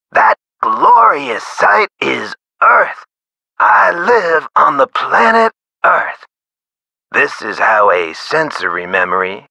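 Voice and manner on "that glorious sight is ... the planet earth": much higher, slow, wonder-struck exclamation; awed sudden recollection. Acted. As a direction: proclaim it slowly and high, like a grand revelation. Voicing a sudden recollection of Earth.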